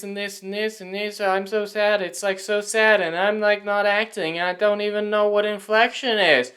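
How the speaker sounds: high, loud monotone parody voice; mocking one-note accent. Vlogger parodying the actress's speech in a high, loud monotone. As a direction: drone it on one high note to mock her. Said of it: "She talked in a one-note accent?".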